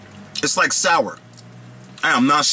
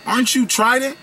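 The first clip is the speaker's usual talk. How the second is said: starstruck Trident voice; 9 semitones higher than usual. Acted. Trident meets Swedish Fish at a coffee shop, high starstruck voice. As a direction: play the gum brand meeting a star. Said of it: "Swedish Fish was like".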